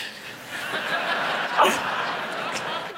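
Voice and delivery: a soft unvoiced chuckle; breathy laugh. Real, not acted. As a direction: laugh quietly at the irony. Laugh at Gideon's doubt.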